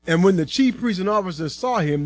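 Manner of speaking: ordinary delivery, nothing marked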